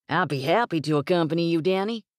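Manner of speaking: eager cowboy, quick and forward. Acted. Cowboy offering to escort the boss's daughter, eager and quick. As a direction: jump in fast to claim the lady.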